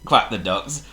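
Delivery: ordinary delivery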